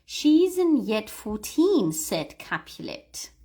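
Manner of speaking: slow, clear line for repeating; slow model line. Shown as a demonstration. Teacher reading a line slowly for learners to copy. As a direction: read it out slowly for them. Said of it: "Let me repeat this one".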